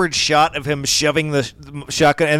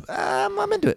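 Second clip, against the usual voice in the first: high and softer, amused; squeaky high; 6 dB quieter than usual. Real, not acted. Podcaster giving in, squeaky and high. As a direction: pipe it up high and quiet.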